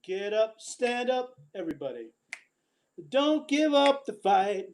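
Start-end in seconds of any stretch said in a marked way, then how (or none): none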